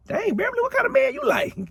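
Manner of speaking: high, teasing squeal; mock girlfriend reaction. A friend teasing Beverly about her taste in men in a high squeal.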